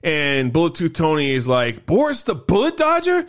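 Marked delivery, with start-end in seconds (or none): 1.8-3.2 high-pitched mock disbelief; imitating someone scoffing, pitched way up; retold incredulous question. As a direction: repeat the nickname as if it's absurd. Retold Tony scoffs at nickname.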